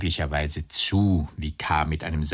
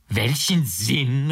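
slow, higher, incredulous echo; more taken aback than angry; dismayed; 5 semitones higher, 3 dB louder, much slower than usual. A narrator's reading. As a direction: draw the question out in dismay. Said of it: "mehr bestürzt als geärgert".

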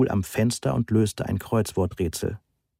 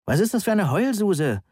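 mildly indignant, pitch swinging high; 10 semitones higher than usual. A narrator's reading. Heike mocking the crying visitor, indignant. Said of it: "der immer noch empörten Frau".

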